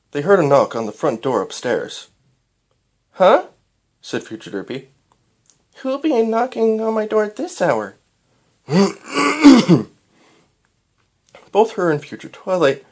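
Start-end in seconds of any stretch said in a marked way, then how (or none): 3.1-3.5 high, loud startled huh; sudden high squeak of surprise. Acted. Character startled awake. 5.8-8.0 higher, puzzled mare voice; lightly voiced puzzled mare; a hint of a confused mother; Future Derpy voice. 8.7-9.9 loud throat clear. Real, not acted.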